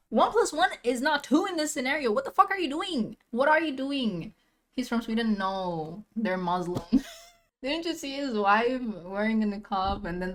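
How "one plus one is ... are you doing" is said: high-pitched, rapid-fire scolding; exasperated disbelief. Real, not acted. Viewer scolding the suspect for his hypocrisy, exasperated disbelief. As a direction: rattle it off, pitched up in outrage.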